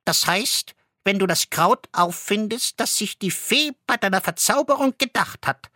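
put-on high, lilting goose girl. A narrator's reading. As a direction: speak up high as the enchanted goose. Goose explains enchantment.

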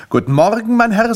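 high, loud, brisk doorstep greeting; chirpy salesman greeting. Acted. Grimpel greets the man at the door, loud salesman pitch. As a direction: greet like an eager little salesman.

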